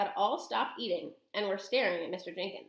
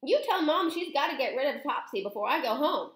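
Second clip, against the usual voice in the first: put-on higher, insistent boy's voice; shouted; 6 semitones higher, 3 dB louder than usual. A narrator's reading. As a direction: call out a firm order. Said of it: "Bruno shouted from inside the handbag".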